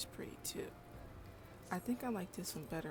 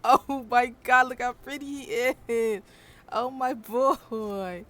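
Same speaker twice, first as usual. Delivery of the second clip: loud, slow, delighted gushing; excited gushing; 5 semitones higher, 14 dB louder, slower than usual. Real, not acted. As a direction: gush over how cute it is. Gushing over a pretty character.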